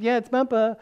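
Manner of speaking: put-on high, loud reply to little kids; cheery grandpa greeting. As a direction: announce yourself to the grandkids. Greeting grandkids on FaceTime, retold.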